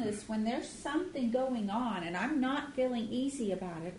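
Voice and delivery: normal talking voice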